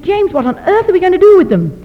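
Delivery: exasperated exclamation. Retold or imitated. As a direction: cry out what on earth, at a loss. Overwhelmed teacher exclaims.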